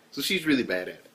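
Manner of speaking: ordinary talk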